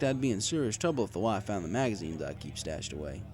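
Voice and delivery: plain reading voice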